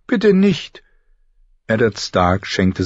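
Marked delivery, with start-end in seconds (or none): 0.1-0.8 put-on high, pleading girl; tearful plea. Sansa begs father not to send them away, pleading. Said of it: "brachte Sansa schließlich hervor".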